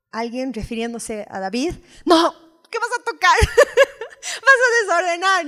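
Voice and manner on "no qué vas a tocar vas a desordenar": high, loud, laughing half-scold; mock scolding. Preacher mimics herself scolding David over her books, laughing. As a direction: shoo them off the books with a laugh.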